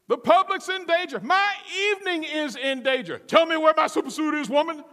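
loud, high, exasperated yelling; Frozone shouting. Acted. Husband ordering wife to produce his suit, urgent shout. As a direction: holler urgently across the house. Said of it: "that Frozone had".

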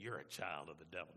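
low, flat, blunt accusation; blunt accuser. Retold or imitated. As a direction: say it like a tactless street preacher. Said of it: "you're gonna make 'em mad".

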